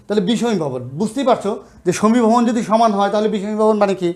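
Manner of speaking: ordinary delivery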